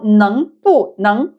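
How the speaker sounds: NENG BU NENG word by word; "neng bu neng" drawn out with separate syllables; slow full tones. Shown as a demonstration. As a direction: say "neng bu neng" slowly, BU falling clearly. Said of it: "When you say it very fast".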